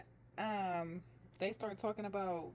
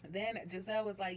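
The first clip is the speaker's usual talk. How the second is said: normal talking voice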